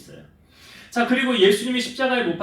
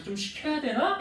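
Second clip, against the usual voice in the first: higher, wondering aloud to himself; a playful self-question, pitched up; mock inner musing; 8 semitones higher, 3 dB quieter than usual. Pastor wondering if he should ask, playful musing.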